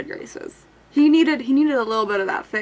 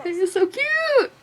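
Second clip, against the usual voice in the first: slow, gushing and high; excited; 10 semitones higher than usual. Real, not acted. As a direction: gush over how cute she is. Gushing over a game character.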